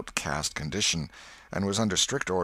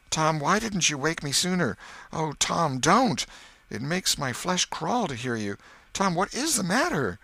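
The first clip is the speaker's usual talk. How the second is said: higher, alarmed pleading; frightened; 10 semitones higher than usual. Acted. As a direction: beg him to stop. Brother frightened at sickbed.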